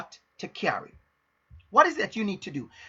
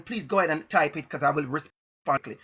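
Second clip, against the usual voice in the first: usual speaking voice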